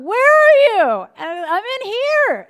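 calling out. Retold or imitated. Walking down hall, calling.

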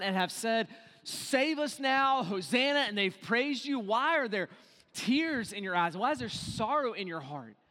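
impassioned preaching. Real, not acted. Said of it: "preacher pleads with Jesus".

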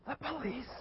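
put-on high breathy whisper of a small child. Little Jimmy whispering his name to the caller, high and hushed. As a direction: whisper your name like a hiding kid. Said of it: "And little Jimmy keeps whispering".

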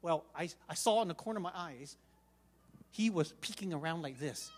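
usual speaking voice

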